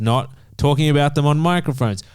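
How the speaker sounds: ordinary talk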